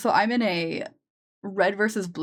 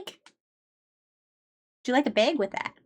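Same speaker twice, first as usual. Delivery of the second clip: slow, sing-song Midwestern accent; 3 semitones higher, slower than usual. Shown as a demonstration. Offering a customer a bag, slow Midwestern accent. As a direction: drawl it like a Minnesota cashier. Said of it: "The Midwest is so painful".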